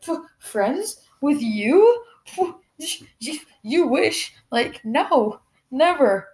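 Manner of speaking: high, slow mocking retort with puffs; scoffing disbelief. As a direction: scoff and swoop up in pitch, incredulous. Acts out wrestler scoffing at friendship.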